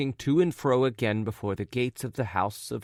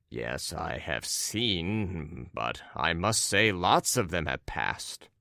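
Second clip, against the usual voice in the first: put-on low-pitched, hesitant young character; puzzled young man; 5 semitones lower, slower than usual. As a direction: answer slowly, low and reserved.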